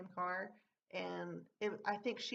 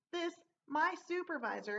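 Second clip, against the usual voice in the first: normal talking voice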